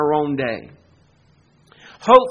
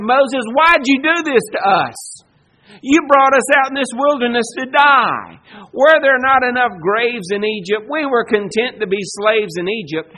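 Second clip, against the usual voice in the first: loud, higher, whiny accusation; hammed-up grumbling at Moses; mock complaining; 4 semitones higher, 6 dB louder than usual. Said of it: "They said".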